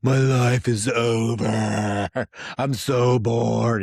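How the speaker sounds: put-on dragged-out whine of a kid stuck at the store; mock bored kid whine. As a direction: ham up a kid dying of boredom. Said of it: "they're like".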